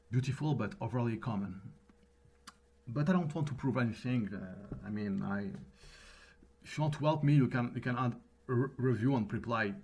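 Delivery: English with a pronounced French accent; thick French accent in English. Real, not acted. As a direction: speak English with strong French R sounds. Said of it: "mon accent français est prononcé, notamment mes R".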